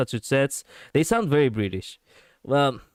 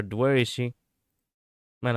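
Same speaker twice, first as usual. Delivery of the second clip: usual speaking voice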